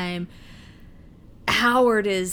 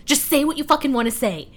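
louder, higher, emphatic demand; exasperated plea; 4 semitones higher, 4 dB louder than usual. Retold or imitated. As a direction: act out shaking someone in frustration. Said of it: "just shake them and go".

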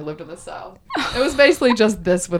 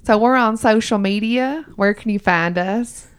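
put-on Southern accent; 2 semitones higher than usual. Host quizzes co-host on their show in a Southern accent. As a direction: quiz her in a playful Southern accent. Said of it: "do the rest of it in my Southern accent".

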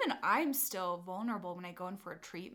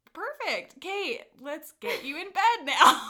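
chirpy therapist voice; 9 semitones higher, 13 dB louder than usual. Retold or imitated. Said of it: "So I was like".

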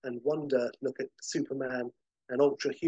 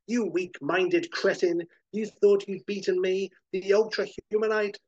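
gloating supervillain; 5 semitones higher than usual. Acted. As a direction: boast like a mad genius who thinks he's won. Villain gloats over dazed Superman.

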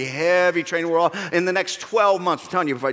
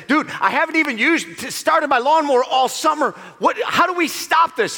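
higher, pleading; a higher voice begging him to stop; mock exasperated neighbor; 9 semitones higher, 3 dB louder than usual.

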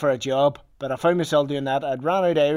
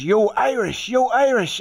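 high-pitched retold street hail; imitated shout across the street; calling out; 7 semitones higher, slower than usual. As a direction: call out to get someone's attention. Someone hails him on the street.

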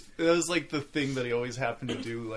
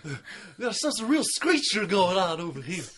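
Harry Carey impression; 10 semitones higher, 7 dB louder than usual. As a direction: boom it out like an old baseball announcer. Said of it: "can you do a little Harry Carey".

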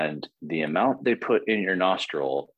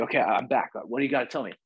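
eager; 6 semitones higher, faster than usual. Retold or imitated. Returning to the spirit.

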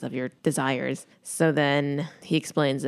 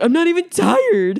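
loud, high-pitched mock tantrum; mock protest; 13 semitones higher, 8 dB louder than usual. Imitating a boy king sent to his room, mock outraged yell. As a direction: protest bedtime like a petulant child, high and loud. Acting out Joffrey's bedtime protest.

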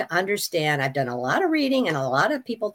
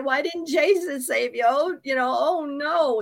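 mock relatives; 7 semitones higher than usual. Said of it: "they didn't wanna hear".

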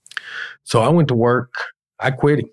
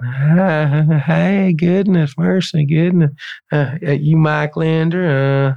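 a lightly put-on higher, gushing voice, slowing on the last question; imitating Kelly's voice; 4 semitones higher, much slower than usual. Retelling the phone call, new boss gushing as he answers, put-on higher voice. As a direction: gush a little, then slowly ask the name. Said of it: "that's exactly how he talked".

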